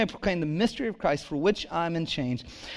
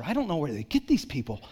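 normal talking voice

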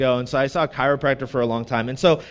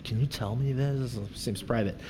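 low, hushed imitation of worried listeners; mock discreet murmur; mock audience unease; 6 semitones lower, 10 dB quieter than usual. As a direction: voice their doubts very softly. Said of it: "You're all like".

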